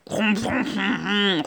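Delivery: low, soft grumbling ron ron; grumpy cat grumbling ron ron at the others; grumbling cat purr. Acted. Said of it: "ronchonne le gros chat grognon".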